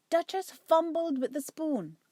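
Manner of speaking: ordinary delivery, nothing marked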